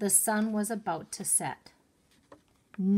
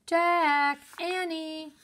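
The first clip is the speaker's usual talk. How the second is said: high loud call from far away; distant cry; 9 semitones higher, 6 dB louder, much slower than usual. A narrator's reading. Mom calls again from afar, a distant cry. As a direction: call them again from the house. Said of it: "came the distant cry again".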